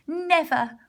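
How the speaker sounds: high, loud defiant cry; cried out. A narrator's reading. Two little pigs defy the wolf, crying out loud. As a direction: fling out a defiant no. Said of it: "cried the two little pig".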